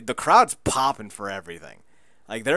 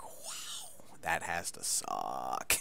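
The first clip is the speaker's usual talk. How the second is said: sarcastic sympathy; 10 semitones lower, 13 dB quieter, slower than usual. Retold or imitated. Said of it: "I was like".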